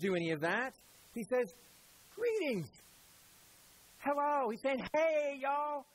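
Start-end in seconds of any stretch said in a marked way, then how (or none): 2.1-4.5 high, breezy casual greeting; casual friendly hello, pitched high; casual Jesus. Retold or imitated. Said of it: "He's, he's just casual". 4.9-5.8 Southern drawl greeting; high, easy Southern hey y'all. Retold or imitated. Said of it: "That's what he's saying in Southern".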